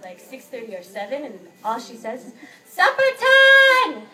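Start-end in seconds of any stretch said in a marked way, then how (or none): 2.7-4.0 high, loud, slow dinner call; host mother calling everyone to supper, loud, high and drawn out. Retold or imitated. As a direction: bellow it across the house, stretched out.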